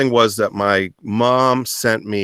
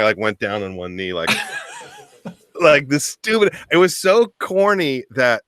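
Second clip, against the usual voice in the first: ordinary talk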